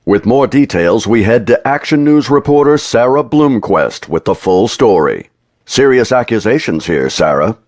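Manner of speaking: loud, punchy TV news anchor. Acted. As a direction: read it like the evening news. Mock news anchor read.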